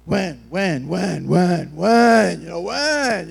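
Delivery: pester, each word pushed harder; impatient nagging. Acted. Believer pestering God for an answer, impatient nagging.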